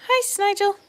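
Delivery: high cooing "Hi, Snigel." again. Real, not acted. Baby talk to the pet.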